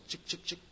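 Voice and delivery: breathy whispered chop-chop-chop; whispered chopping noise. Retold or imitated. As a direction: whisper the chops like an axe falling. Imitating the axe chopping.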